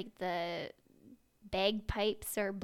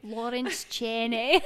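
put-on slow, drawn-out Scottish accent; Lawrence Chaney; 2 semitones higher, 6 dB louder, slower than usual. Podcast host showing her one Scottish impression, drawn-out accent. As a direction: stretch the name out in a playful Scottish accent. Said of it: "That's the only Scottish".

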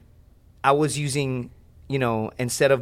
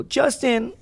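mimicking partner calling; 11 semitones higher than usual. Partner waking him, calling his name.

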